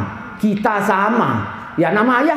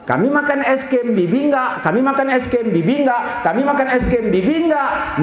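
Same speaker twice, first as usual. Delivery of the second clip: high sing-song playground teasing; repeated high teasing chant; children's taunting; 6 semitones higher than usual. Retold or imitated. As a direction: imitate kids mocking a left-out friend. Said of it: "ngejek pula".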